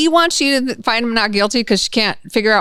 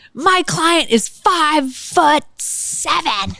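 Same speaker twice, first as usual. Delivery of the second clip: theatrical defense lawyer; 7 semitones higher, much slower than usual. Retold or imitated. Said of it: "very theatrical and screaming and yelling".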